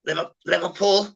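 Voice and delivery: high, twangy fake Scouse "Liverpool"; Scouse twang. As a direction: put a forced twang on "Liverpool". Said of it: "they put a twang on".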